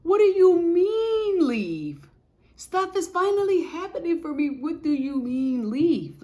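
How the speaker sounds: high, loud, slow protesting wail; mock tantrum. Arguing with God about leaving, loud high wail. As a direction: wail it like a baby kicking. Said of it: "kicking and screaming".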